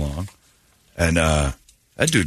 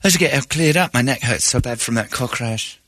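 higher-pitched mock English accent; imitated English accent; 4 semitones higher than usual. Host mimicking the woman's car crash story, mock English accent.

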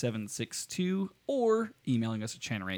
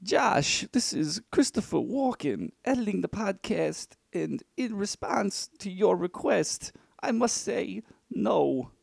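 high, slow, halting celebrity impression; hammed-up impression with drawn-out, oddly placed phrasing; terrible celebrity impression, pitched up and slow; Christopher Walken; 8 semitones higher, slower than usual. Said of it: "terrible impression of Michael Caine talking to Christopher Walken".

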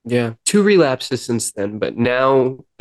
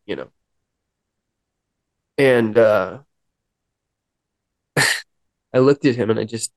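ordinary talk

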